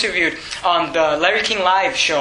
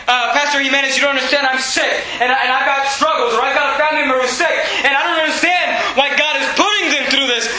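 lightly voiced whiny congregant, raised higher; mock complaining listener; 8 semitones higher than usual. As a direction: play the doubter's complaint, half-mocking. Preacher voices a doubting listener.

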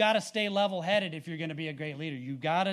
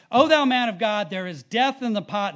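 louder, raised cry from scripture; 4 semitones higher, 10 dB louder than usual. A narrator's reading. Reading to the congregation the men's alarm at the pottage, crying out. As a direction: read it as a loud, narrated outcry. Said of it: "they cried out and said".

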